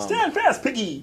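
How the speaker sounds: high hammy warrior voice; mock Norse hero command; viking. Thor voice continues.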